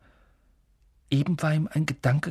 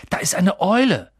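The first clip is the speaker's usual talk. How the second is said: raised, higher voice; Harry, loud; 6 semitones higher, 7 dB louder than usual. Acted. Boy telling sleeping Hagrid about an owl, loud. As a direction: loudly alert a sleeper. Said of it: "sagte Harry laut".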